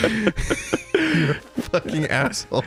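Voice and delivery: laughing. Real, not acted. Laughing insult at friend.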